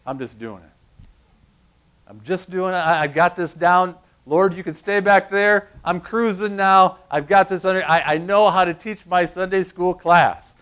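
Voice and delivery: a hint of a smug know-it-all; smug self-reliance. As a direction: brag like you've got it all handled. Cocky believer who doesn't need God.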